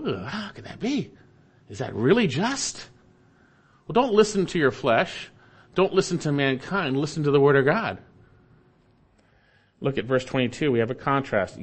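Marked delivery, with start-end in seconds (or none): 0.0-2.9 fleshly protest. Retold or imitated. Preacher voicing the flesh doubting judgment, groaning disbelief. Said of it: "In our flesh, we go". 3.9-8.0 raised, urgent exhortation; preaching. Real, not acted. Preacher urging congregation to trust God's word, urgent.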